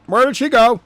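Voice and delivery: a surprised soldier demanding; lightly voiced baffled soldier; voiced soldier asking. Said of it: "asked the man".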